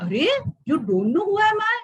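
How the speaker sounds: high, loud, outraged royal voice; a proud king insulted that he is not known; affronted ruler demanding recognition; indignant king. Acted. King boasting to the sadhu.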